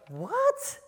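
high-pitched, disbelieving "what"; acted shrill-rising disbelief; incredulous reaction. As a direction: react as a baffled, doubting crowd. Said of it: "They're like".

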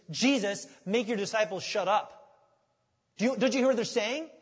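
loud, indignant mock Pharisee; mad. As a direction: scold in acted outrage. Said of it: "they were just all mad".

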